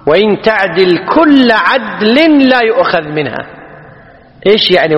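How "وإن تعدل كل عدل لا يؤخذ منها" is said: slow, raised, drawn-out recital; Quran quotation. Acted. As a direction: quote the verse slowly, lifting the pitch. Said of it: "ولذلك جاء في القرآن". (تلاوة آية ببطء وصوت مرتفع الطبقة)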